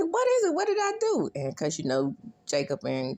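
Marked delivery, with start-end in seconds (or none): 0.0-1.3 very high, louder frantic squeal; panicked squeak. Retold or imitated. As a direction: shriek it like a flustered teacher. Voicing the panicked teachers.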